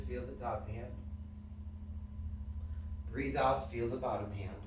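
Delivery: soft, low meditation guidance with long pauses; guided breathing. Shown as a demonstration. Leads a breathing exercise.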